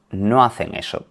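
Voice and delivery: ordinary delivery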